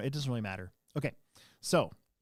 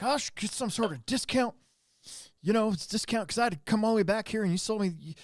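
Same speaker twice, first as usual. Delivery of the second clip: much higher mock voice, haggling for a discount; demanding customer; 9 semitones higher, 4 dB louder than usual. Customer demanding a discount from the manager, mock high. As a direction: play the pushy customer wanting money off. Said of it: "he's like".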